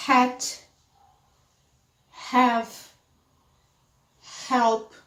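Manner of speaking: isolated model words "Hat, have, help" with audible H; pronounced H. Shown as a demonstration. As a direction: clear aspirated H opening "Hat", "have", "help". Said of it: "Eu preciso pronunciar".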